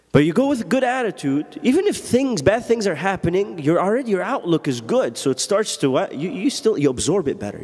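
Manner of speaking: high, ringing preacher's voice, slowing on the verse; full-force preaching. Acted. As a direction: preach it out to the congregation.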